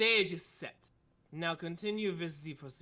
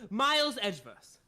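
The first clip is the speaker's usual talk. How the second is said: put-on German accent; 4 semitones higher, 5 dB louder than usual. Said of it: "It's so hard to do the accent".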